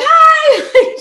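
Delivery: high, loud, laughing hi; manic cheerful hi. Retold or imitated. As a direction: chirp it like a crazed cheerleader. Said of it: "Who is that psycho lady?".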